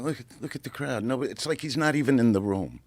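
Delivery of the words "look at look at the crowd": softer, fast aside to a friend; hushed aside. Retold or imitated. Comedian nudging his friend about the crowd, hushed and quick. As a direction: mutter it quick under the breath. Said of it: "I'm looking at my friend going".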